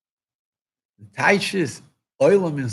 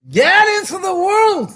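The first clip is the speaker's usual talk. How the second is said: much higher, loud rallying cry; fervent shout; 12 semitones higher, 4 dB louder than usual. Real, not acted. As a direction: call the crowd out into the world. Preacher's climax call.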